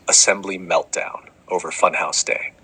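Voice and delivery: ordinary talk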